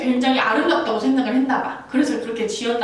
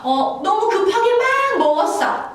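high, louder complaint; 10 semitones higher, 4 dB louder than usual. Acted. Acted complaint.

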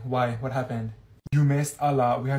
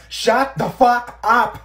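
slow, loud, high yell; retold shout; 8 semitones higher, 11 dB louder, much slower than usual. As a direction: bellow it out slowly. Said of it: "so I was just like".

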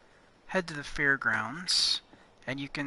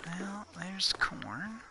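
whispering; 4 semitones higher, 7 dB quieter, slower than usual. Real, not acted. Whispering while looting.

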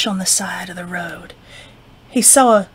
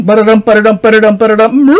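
galloping sound; 9 dB louder, faster than usual. Acted.

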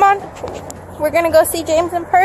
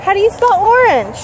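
put-on talking to a toddler; 4 semitones higher, 3 dB louder than usual. Praising and quizzing toddler.

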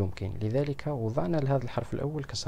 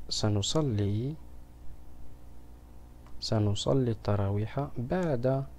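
slow, word dragged out for writing; slow dictation; 3 dB quieter than usual. Shown as a demonstration. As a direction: spell سنصلي out slowly, syllable by syllable. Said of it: "إذن س-،". (إملاء بطيء لكلمة سنصلي)

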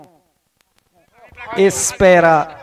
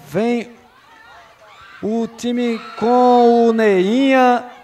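normal talking voice

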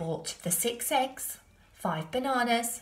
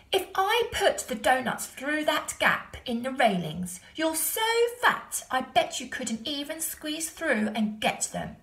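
put-on higher, louder teasing boy; mock the pig playfully; cheeky boy taunt; 7 semitones higher, 4 dB louder than usual. A narrator's reading.

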